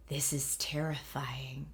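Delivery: slow, hushed whisper into the mic; unnerved stage whisper, drawn out. Retold or imitated. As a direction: whisper it slowly, like a secret aside on a recording. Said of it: "I whispered into the mic".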